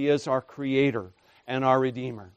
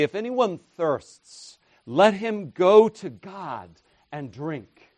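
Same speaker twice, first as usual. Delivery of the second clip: louder and slower, pitched up; David's version, proclaimed; 4 semitones higher, 4 dB louder than usual. Retold or imitated. Preacher voicing David for contrast, louder and slow. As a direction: declare it like a psalmist. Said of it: "David would say".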